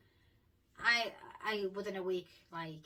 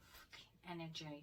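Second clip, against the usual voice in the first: muttered curse; 9 dB quieter than usual. Retold or imitated. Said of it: "just like that".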